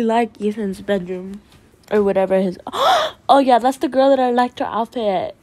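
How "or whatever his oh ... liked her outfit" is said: ordinary talk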